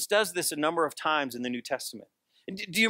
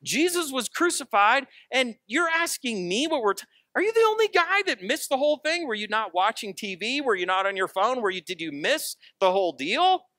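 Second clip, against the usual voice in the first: incredulous scolding; 7 semitones higher than usual. Retold or imitated. Cleopas scolding the stranger on road.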